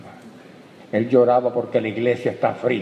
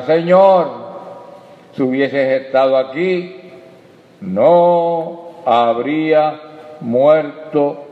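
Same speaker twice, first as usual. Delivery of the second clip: raised, drawn-out plea; loud lament; 3 semitones higher, 6 dB louder, much slower than usual. Retold or imitated. Mary reproaching Jesus over her brother's death, loud drawn-out lament. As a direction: cry it out loud, stretching the last words.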